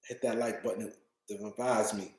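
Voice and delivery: low, drained, sleepy mumble; tired voice, trailing off softly. Real, not acted. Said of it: "Y'all can see I'm tired".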